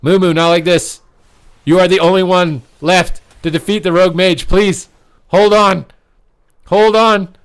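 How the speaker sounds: high, loud begging; will him to survive; desperate cheering plea; pleading shout. Real, not acted. Begging last player to survive.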